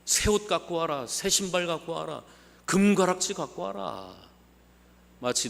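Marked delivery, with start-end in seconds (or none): 0.0-4.1 raised, higher calls to the servants; father calling his servants, raised voice; father shouting orders. Retold or imitated. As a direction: call out the orders like a joyful father. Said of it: "종들에게 소리를 지르죠".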